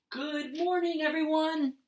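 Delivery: high, cheerful call to everyone; Billy's greeting. Acted. Teacher voicing Billy saying good morning. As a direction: greet the room like a happy boy. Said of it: "said Billy".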